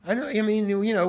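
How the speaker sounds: ordinary talk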